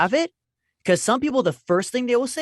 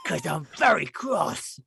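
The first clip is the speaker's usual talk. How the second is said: put-on slow, cross British boy; mock-cross British schoolboy; Nigel; 3 dB quieter, slower than usual. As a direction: declare it slowly in a put-on British accent. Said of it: "his whole thing was like".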